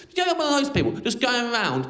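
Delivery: ordinary talk, nothing marked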